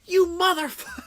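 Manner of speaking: very high mock-furious cry; retold mad outburst. Streamer re-enacts cursing the controls, high mock fury. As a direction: curse the game in a high exasperated wail. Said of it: "I was getting so mad".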